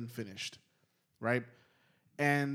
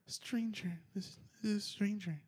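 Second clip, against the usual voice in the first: high, soft dreamer's voice; halting words as if talking in sleep; imitated sleep talker; 6 semitones higher, 9 dB quieter than usual. Voices partner mumbling in sleep.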